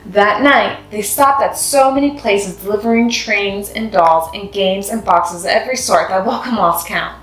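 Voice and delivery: plain reading voice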